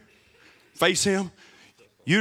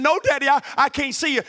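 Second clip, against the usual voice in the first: put-on little girl; 7 semitones higher than usual. Little girl voiced in story.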